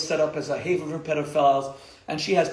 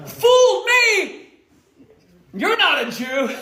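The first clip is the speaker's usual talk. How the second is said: mock exclamation; 9 dB louder, slower than usual.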